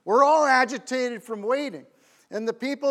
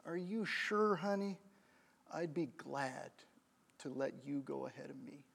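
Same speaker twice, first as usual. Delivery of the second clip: very soft, sincere offer; hushed, compassionate voice; tender kind woman; 8 semitones lower, 15 dB quieter than usual. Retold or imitated. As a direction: let it sink low and gentle. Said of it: "the lady at the front says".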